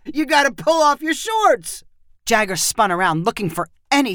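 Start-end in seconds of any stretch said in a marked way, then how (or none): 0.0-1.8 higher, yelled through laughter; laughing yell. A narrator's reading. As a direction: shout it while gasping for air. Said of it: "he yelled at Jagger".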